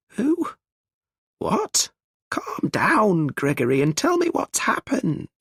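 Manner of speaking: shocked high voice; alarmed mother. Acted. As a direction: urge him to calm down, frightened. Mum alarmed, calming Gregory.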